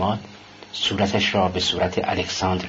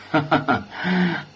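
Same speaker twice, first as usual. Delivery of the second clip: short laugh; 5 semitones higher than usual. A narrator's reading. Man amused at being asked how he knows, short laugh. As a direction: let out a small acted laugh. Character laughs before answering.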